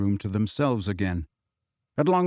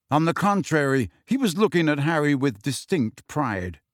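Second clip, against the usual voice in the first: ordinary delivery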